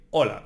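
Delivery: hola with a silent h, starting on the o. Shown as a demonstration. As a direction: drop the h of hola entirely. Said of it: "So always the H is silent".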